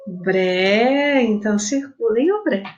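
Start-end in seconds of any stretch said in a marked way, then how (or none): 0.1-1.2 "Bré" held long on the vowel; sílaba alongada. Shown as a demonstration. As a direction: stretch out "Bré" to show the stressed syllable. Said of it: "Qual que eu demorei mais?". ("Bré" bem demorado na vogal)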